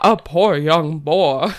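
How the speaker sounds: slow, laughing imitation of a southern drawl. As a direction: mimic a friend's drawn-out southern drawl. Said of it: "like a deep southern drawl".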